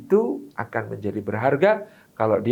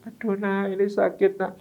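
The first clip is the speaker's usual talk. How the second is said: mock old parent; 5 semitones higher, 3 dB quieter than usual. Aging parent complains of pain.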